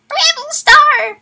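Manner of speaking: high loud slow shriek; villain shriek. Acted. Asher shrieking after the escaped ThunderClan leader, high shriek. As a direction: scream his name after him. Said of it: "Asher shrieked".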